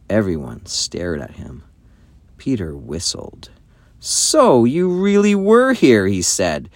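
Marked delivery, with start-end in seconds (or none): 4.0-6.1 slow, high accusation; indignant, slow and pitched up; accusing. A narrator's reading. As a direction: confront a caught liar, drawing the words out. Peter confronts Edmund over his lie.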